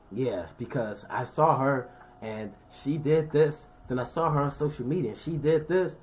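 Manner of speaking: jealous sister gossiping. Retold or imitated. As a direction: drag out the made-up story. She gonna make up some stuff.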